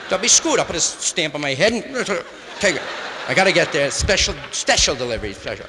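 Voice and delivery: loud mock excitement. Joking about getting a postman to deliver him, loud mock announcing. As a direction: play it up like a goofy announcement. Imagines mailing himself.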